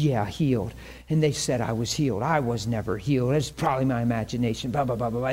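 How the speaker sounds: imitating a bitter, sulking complaint; grumbling mad. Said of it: "He's just mad".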